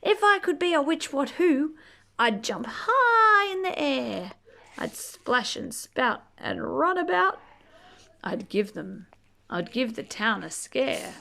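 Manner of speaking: gentle storybook read-aloud; reading to children. Acted. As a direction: read it like a bedtime picture book. Reading picture book aloud.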